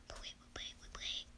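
breathless whispered repeated wait; hushed whisper. Real, not acted. Whispering while hiding from the monster. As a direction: whisper so the monster won't hear.

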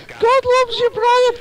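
high, loud preacher cry; high shouted catchphrase. Acted. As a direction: proclaim it like a street preacher. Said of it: "do it again".

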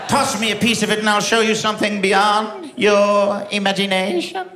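mysterious pirate lady. Retold or imitated. Pirate lady tempting the comic for turkey: sly enticing voice. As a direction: lure him in like a sideshow mystic.

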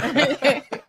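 a squeal of high, loud laughter; real high-pitched laugh. Real, not acted. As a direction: burst out laughing. Reacting to the joke.

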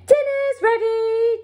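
high, bright call out; announcing. A narrator's reading. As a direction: call everyone to the table. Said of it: "Jack announced".

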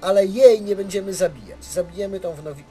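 usual speaking voice

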